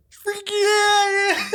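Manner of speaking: high, loud, slow yelp of pain; shocked by an outlet, yelling out in pain; retold scream. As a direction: shriek it like you just got shocked. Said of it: "I've screamed it out loud".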